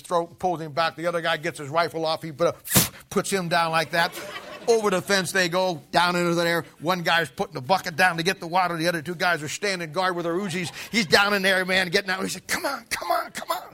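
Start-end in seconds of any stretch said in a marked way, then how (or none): 4.7-13.7 louder, building sermon storytelling; preacher racing through the night raid, loud and building; excited preaching. Acted. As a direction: drive the battle story like a preacher on fire.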